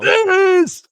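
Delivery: excited shout. Real, not acted. Excited agreement in fan chat.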